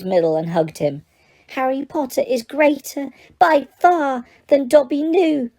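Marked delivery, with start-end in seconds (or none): 1.5-5.5 mild elf sob. Acted. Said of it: "he sobbed".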